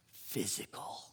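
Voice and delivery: hushed whisper; awed whispered answer. Real, not acted. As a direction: drop to a breathy whisper. Answers his own question in a hush.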